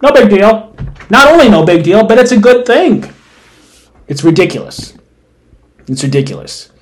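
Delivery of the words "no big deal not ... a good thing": high, loud incredulous paraphrase; mocking the article. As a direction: parody the piece's blithe claim. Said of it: "a piece from Bloomberg that says".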